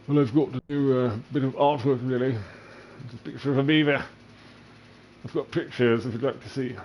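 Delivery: high mock British voice; fake British accent. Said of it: "speak with a fake British accent".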